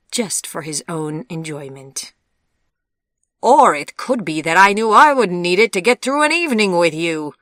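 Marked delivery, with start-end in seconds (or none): 3.4-7.3 sharp retort, higher and louder; snapping back. A narrator's reading. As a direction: fire it back at him in temper. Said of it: "snapped back Anastasia".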